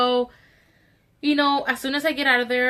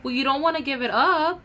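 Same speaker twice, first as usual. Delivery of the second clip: patronizing; 4 semitones higher than usual. Retold or imitated. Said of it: "he has the nerve to be like".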